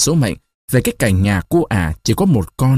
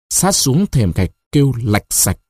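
ordinary delivery, nothing marked

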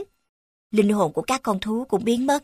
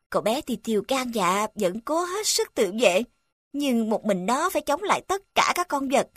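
ordinary delivery

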